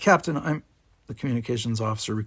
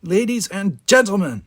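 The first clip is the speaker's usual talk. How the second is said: sharp, loud and high; officer cutting into the bridge uproar sharply; sharp cut-in; 9 semitones higher, 8 dB louder than usual. A narrator's reading. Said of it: "a voice cut in sharply from behind him".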